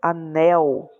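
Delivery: Anel with a long, strong "nel"; stress on last syllable. Shown as a demonstration. Teacher demonstrating oxítonas, stress on "nel" in Anel. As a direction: lean on the end of Anel. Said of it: "Tá vendo que é a última?".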